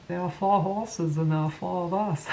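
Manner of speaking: lightly voiced gentle giant; Andre the Giant. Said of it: "the need to do that impression".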